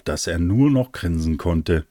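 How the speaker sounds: usual speaking voice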